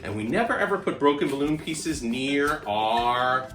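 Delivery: ordinary delivery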